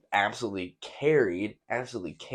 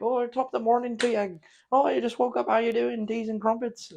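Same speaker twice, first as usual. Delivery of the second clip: much higher, hammed-up mock British accent; 4 dB louder than usual. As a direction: play a silly British gamer saying good morning. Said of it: "And they're like".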